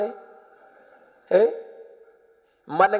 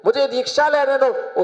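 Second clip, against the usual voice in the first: much higher, pressing repeat; insistent retold plea; 5 semitones higher than usual. Son begging mother to allow initiation, insistent. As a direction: plead again on a raised pitch.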